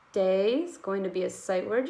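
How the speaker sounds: normal talking voice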